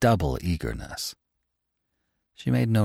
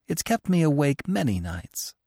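a working mother's anxious, earnest confession; earnest mother pleading; 6 semitones higher, 4 dB louder than usual. Acted. Mother confides in the author, pleading and earnest. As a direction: pour out her worry about the children.

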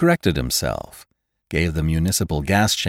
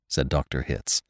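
ordinary delivery

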